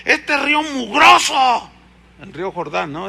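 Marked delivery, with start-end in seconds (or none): 0.0-1.7 slow, high, louder disgusted complaint; angry disgust. Retold or imitated. Offended general scorning the dirty river, high and disgusted. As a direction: spit out the insult at the river. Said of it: "se enoja y se va todo enojado".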